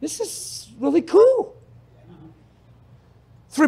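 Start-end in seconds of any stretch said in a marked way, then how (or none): 0.0-1.5 tearful awe. Retold or imitated. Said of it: "driving down the road crying".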